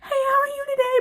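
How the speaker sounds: very high, strained fake greeting; squeaky mock-composed customer greeting; pretending to be normal. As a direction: greet a customer while badly hiding panic. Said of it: "trying to pretend like I'm normal".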